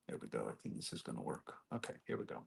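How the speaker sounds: low, unvoiced under-breath mutter; muttering to himself. Real, not acted. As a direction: whisper it to yourself.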